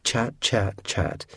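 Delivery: a ghost sneering to himself; muttered. Acted. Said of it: "he muttered".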